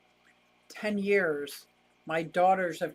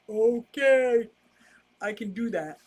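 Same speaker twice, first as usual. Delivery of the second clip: a lightly altered silly voice, a bit higher and louder on okay; odd put-on voice; 4 semitones higher, 5 dB louder than usual. Reading a viewer's chat, an odd silly voice. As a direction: playfully slip into a goofy character voice. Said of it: "I don't know why I'm talking like that".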